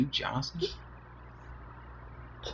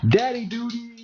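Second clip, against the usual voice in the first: playful; 10 semitones higher than usual. Real, not acted. Dad jokes about duties.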